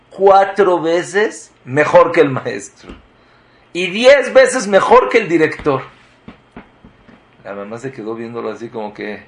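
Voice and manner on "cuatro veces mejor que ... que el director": rising proclamation. Retold or imitated. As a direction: proclaim it, swelling with certainty. Rabbi proclaims the boy's future.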